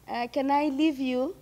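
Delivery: ordinary talk, nothing marked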